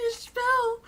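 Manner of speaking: high, loud call of joy; overjoyed mother's cry; cried out name. Acted. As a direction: call out to your revived child. Mother sees revived child.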